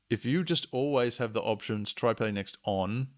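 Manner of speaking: ordinary delivery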